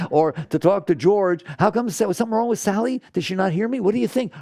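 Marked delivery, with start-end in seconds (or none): 1.6-4.3 high, fast fretting chatter; mock worried questioning, higher and quick; anxious mock gossip. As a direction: fret to a friend, needing reassurance. Fretting to George about Sally.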